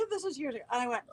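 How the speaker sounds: usual speaking voice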